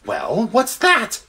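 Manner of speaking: high, drawn-out comic question; baffled animal. Acted. Creature asking another about weevils, high and baffled. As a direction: stretch it out in bewilderment.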